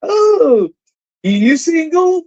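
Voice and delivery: high, pushy mock flirting voice; mimicking flirty woman. Caller imitates women flirting with him abroad, high mock voice. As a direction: play the woman asking if he's single. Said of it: "I was like".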